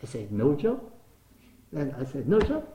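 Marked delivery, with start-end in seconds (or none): 2.3-2.6 higher and louder, stunned question; can't believe it is this easy; amazed disbelief. Retold or imitated. Repeats the surprising news, louder.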